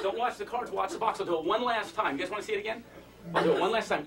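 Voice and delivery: usual speaking voice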